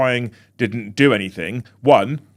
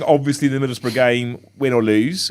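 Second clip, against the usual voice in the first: ordinary delivery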